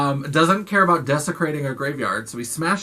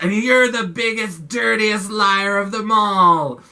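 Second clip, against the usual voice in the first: higher, louder, slowing on the last part; intense accusation; 6 semitones higher, 5 dB louder, slower than usual. Retold or imitated. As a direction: lean in and condemn her. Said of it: "he's, like, so intense".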